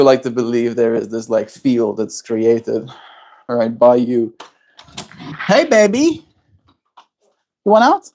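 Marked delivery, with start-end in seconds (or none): 5.5-8.0 high, sing-song cooing at the bird; sweet high pet talk. Real, not acted.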